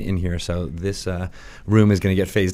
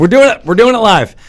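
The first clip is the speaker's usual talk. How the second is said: loud, high, hammed-up echo; shouted catchphrase; 10 semitones higher, 8 dB louder than usual. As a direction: yell it back gleefully. Echoing the catchphrase loudly.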